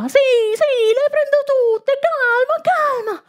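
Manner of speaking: high, flustered placating voice; calm the crowd while losing control; flustered marshal. Acted. Swamped marshal placating women.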